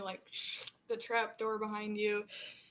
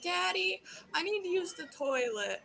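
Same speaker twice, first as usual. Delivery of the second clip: lightly voiced small girl, higher and pleading; a child tugging at her dad; little girl; 7 semitones higher than usual. Little girl asks her daddy for the toilet, lightly high and whiny.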